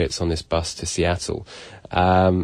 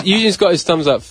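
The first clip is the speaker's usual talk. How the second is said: normal talking voice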